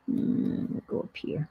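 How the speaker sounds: "go up here" fully whispered. Real, not acted. Deciding where the piece goes, whispered to herself. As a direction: whisper it to yourself.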